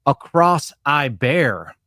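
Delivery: very slow, spelling it out to a slow learner; slow explaining boss. Retold or imitated. Boss patiently spells the phrase out for his employee, very slow. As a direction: drag each word like explaining to a kid. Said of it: "can you use it in a sentence".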